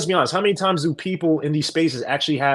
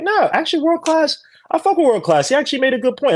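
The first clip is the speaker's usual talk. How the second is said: much higher, excited imitation of a Fatty fan; mock Fatty fan; 11 semitones higher than usual. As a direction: play the Fatty fan conceding, voice up high. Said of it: "People from Fatty's side be like".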